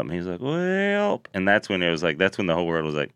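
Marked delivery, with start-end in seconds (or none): 0.4-1.2 high, drawn-out mock hesitation; hesitant well. Joel Osteen stalling when asked to open up, hesitant. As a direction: stall on a high, stretched-out word. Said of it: "and then he hesitated".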